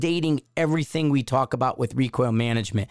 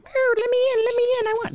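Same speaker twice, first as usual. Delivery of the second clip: very high, thin whine put on for the dog; whining dog voice. Host voicing his whining dog at the door, high begging whine. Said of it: "He's all whine".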